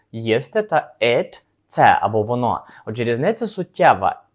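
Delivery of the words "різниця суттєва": normal talking voice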